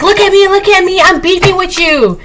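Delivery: high sing-song mockery of a desperate person; desperate attention seeker. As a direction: mimic someone begging to be noticed. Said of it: "something desperate about that".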